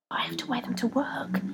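pleading whisper; imploring whisper. A narrator's reading. Rebecca pleading with her feet, whisper. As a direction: beg softly. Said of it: "implored Rebecca".

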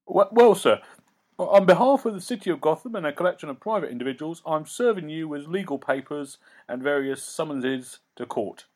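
a jittery clerk rattling off the charges; nervous lawyer. Acted. The lawyer serves Batman papers in a high nervous rush.

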